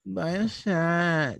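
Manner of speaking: drawn-out mock party girl; hammed-up party girl, stretched out; slow girly plea. Mocking girls asking for drinks.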